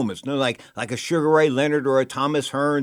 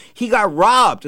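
mimicking Marcia; 8 semitones higher, 6 dB louder, much slower than usual. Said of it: "She goes".

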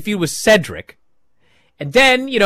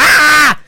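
crowd scream; 9 semitones higher, 7 dB louder than usual. Retold or imitated. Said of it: "'cause everyone's".

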